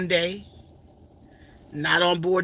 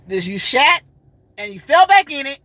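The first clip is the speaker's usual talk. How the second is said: blunt vent; 6 semitones higher, 6 dB louder than usual. Real, not acted. Venting bluntly.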